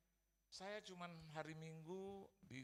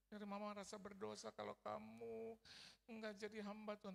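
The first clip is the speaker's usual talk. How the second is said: tearful pleading; 7 semitones higher than usual. Retold or imitated. Mother begging her son to serve God, tearfully. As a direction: plead with a mock-weepy wail. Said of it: "sampai nangis memohon".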